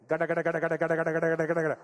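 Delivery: dad imitating his broken engine; Indian dad's engine noise. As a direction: chug out "gada" like a dying engine. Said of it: "make everything sound Indian".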